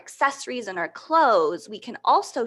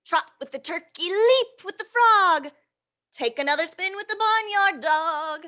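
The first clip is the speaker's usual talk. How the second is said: put-on lively read-aloud to little kids; reading to children; 8 semitones higher, 4 dB louder than usual. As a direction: read the rhyme with bounce for the children. Reading picture book aloud.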